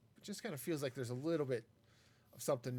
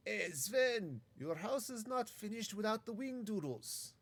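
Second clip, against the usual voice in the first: higher, hammed-up bad Viking accent; 7 semitones higher, slower than usual. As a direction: play a Viking nagging his neighbour Sven, badly. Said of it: "That was not a good accent".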